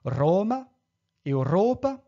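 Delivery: "Roma, Europa" stressed RO-ma, eu-RO-pa; loud stressed RO in "Roma" and "Europa"; penultimate stress. Shown as a demonstration. Teacher giving examples, "Roma, Europa" stressed on second-last syllable. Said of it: "un accent sur la seconde syllabe".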